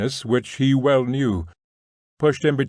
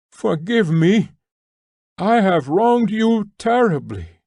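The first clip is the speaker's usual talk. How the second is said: high, slow, raised plea; loud voice; 8 semitones higher, 3 dB louder than usual. A narrator's reading. Nekhludoff begging Maslova's forgiveness, loud and slow. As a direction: say it loud and slow, bearing the shame. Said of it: "he continued in a loud voice".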